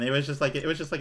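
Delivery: ordinary talk, nothing marked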